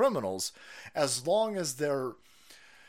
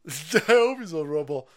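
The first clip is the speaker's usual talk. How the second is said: louder, laughing repeat; 3 semitones higher, 7 dB louder than usual. Real, not acted. Host cracks up hoping a guest is on Rumble, laughing. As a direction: crack up as you say it.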